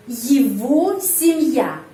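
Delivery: Его семья spoken slowly, first vowel reduced to i; careful slow Russian phrase; slow Russian demo. Shown as a demonstration. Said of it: "Huruf Y dibaca I".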